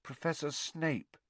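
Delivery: soft, slow frightened mumble; a scared boy hardly daring to say it; barely a whisper. A narrator's reading. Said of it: "in barely more than a whisper".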